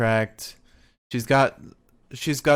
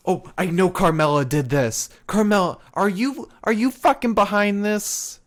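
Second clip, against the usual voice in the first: higher-pitched indignant accusing; raised, acted suspicion; mock accusation; 7 semitones higher than usual. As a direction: accuse your rival in a put-on huff. Acting Sasha accusing her rival.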